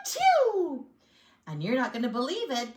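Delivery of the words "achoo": high, drawn-out mock sneeze; great big sneeze. A narrator's reading. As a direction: let a big acted sneeze burst out. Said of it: "I sneezed a great big sneeze".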